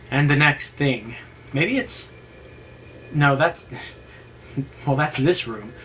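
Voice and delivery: ordinary delivery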